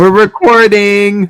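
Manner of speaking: high, loud happy announcement; excited. Real, not acted. Friend announcing recording to the group, excited and loud. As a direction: sing out that the tape is rolling.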